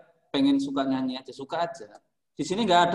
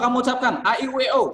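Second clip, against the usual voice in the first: higher, louder, crisp vowels; teacher modelling articulation; clear vowel prompt; 7 semitones higher, 4 dB louder, faster than usual. Shown as a demonstration. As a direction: call out the vowels crisply for the class. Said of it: "teacher prompting vowels".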